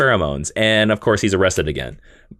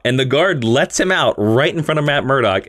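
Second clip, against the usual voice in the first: usual speaking voice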